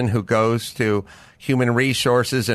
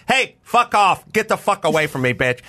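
retold telling-off; 5 semitones higher than usual. Comedian retells confronting a crazy neighbor, retold telling-off. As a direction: re-enact chasing off a pest, mildly heated.

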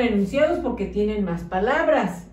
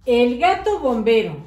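put-on reading to children; 2 semitones higher than usual. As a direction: read the tale aloud to the class. Teacher reads story to children.

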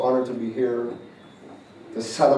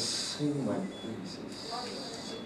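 drawn-out command; 4 semitones higher, 13 dB quieter, much slower than usual. Acted. Prophesying God's command.

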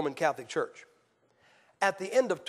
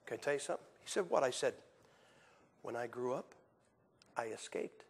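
quiet, low, secretive; hushed confiding; 8 semitones lower, 12 dB quieter than usual. Retold or imitated. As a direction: share a secret softly. Confiding to kid on plane.

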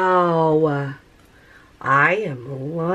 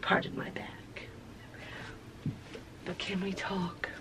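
hushed confiding whisper; 16 dB quieter than usual. Real, not acted. Vlogger confiding to viewers, whisper. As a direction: lean in and whisper.

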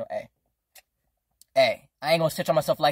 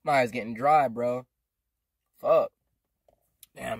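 ordinary talk

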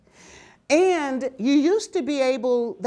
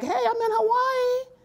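high, carefree self-quote; retold delight; 13 semitones higher than usual. New arrival at Pearl Harbor job, high delighted voice. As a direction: play up the delight, pitched well up.

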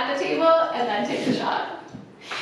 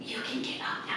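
breathy whisper with nervous laughs; 13 dB quieter than usual. Real, not acted. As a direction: whisper it as a game rule. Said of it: "I have to whisper until my next".

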